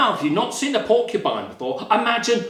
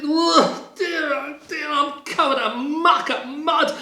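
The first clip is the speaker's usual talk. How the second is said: high-pitched whining little bunny; dismayed bunny; 8 semitones higher than usual. Acted. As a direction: wail it high, in mock dismay. Muddy bunny complains.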